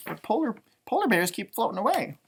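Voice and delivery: ordinary delivery, nothing marked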